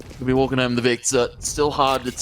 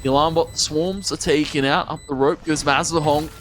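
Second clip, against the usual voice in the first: rising excited play-by-play, higher; esports caster; 4 semitones higher than usual. Acted. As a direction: call the clutch live, climbing in pitch. Live play-by-play of round.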